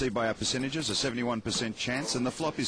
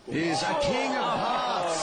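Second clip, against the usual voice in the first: excited call; 11 semitones higher, 3 dB louder, slower than usual. Real, not acted. River card decides the hand.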